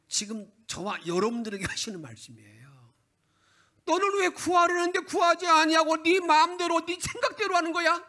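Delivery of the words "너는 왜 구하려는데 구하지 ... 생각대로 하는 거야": much higher and louder; God's loud rebuke. Retold or imitated. God rebukes the congregation for not asking, loud and high. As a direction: rebuke them as a stern God.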